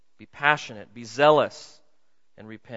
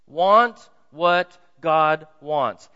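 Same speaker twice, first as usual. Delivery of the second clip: louder, each word drawn out slow; slow loud emphasis; 10 dB louder, much slower than usual. Shown as a demonstration. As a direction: call it out slowly, driving it home. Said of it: "Let me say it this way".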